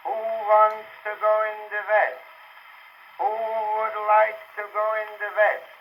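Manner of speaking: high, drawn-out train announcer's call. Acted. New train dispatcher announcing departures to the depot, calling out. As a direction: sing out the stops, long and high. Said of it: "calls out the trains".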